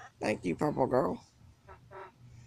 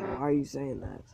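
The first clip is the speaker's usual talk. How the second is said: ordinary talk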